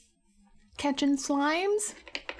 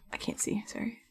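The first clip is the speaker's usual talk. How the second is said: whispered aside; 8 dB quieter than usual. Real, not acted. Quiet aside.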